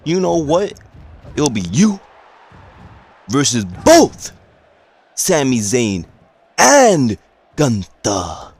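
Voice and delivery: a general manager bellowing the match to the crowd; booming announcement. Retold or imitated.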